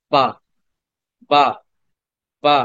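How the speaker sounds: বা said short and clipped with no lengthening; short ba, not drawn out. Shown as a demonstration. Teacher to online class, reciting বা short and unstretched. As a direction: say বা quickly, one beat, no drawn vowel. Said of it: "এখানে কিন্তু টানা যাবে না".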